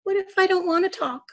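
a hint of a defiant child, high-pitched; sulky boy. A narrator's reading. Sulky grandson answering his grandmother back, lightly defiant.

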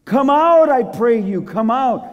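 high, loud opening call; king pleading from afar; urgent summons. Acted. King begs them to come out.

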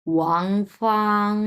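ফাং stretched long on a level tone; drawn-out level tone. Shown as a demonstration. Teacher stretches the name for students, long and level. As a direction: hold ফাং long and flat. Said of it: "ফাং এরকম টানা".

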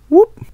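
quick high rising whoop; high peeking whoop. Acted. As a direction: pop up with a tiny high whoop. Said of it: "peek around the corner like".

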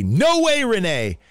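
high, drawn-out "No way, Renee"; preacher's booming call. Retold or imitated. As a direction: belt it out like a preacher rallying a crowd. Acted preacher's call to audience.

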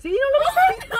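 high, breaking into laughter; laughing. Retold or imitated. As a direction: push the words out through giggles. Retelling funny scene, laughing. (entre carcajadas, agudo)

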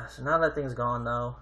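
usual speaking voice

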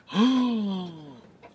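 high, loud mock gasp of shock; shocked group gasp. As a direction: gasp as if seeing the impossible. Everyone shocked at the veil.

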